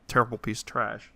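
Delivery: usual speaking voice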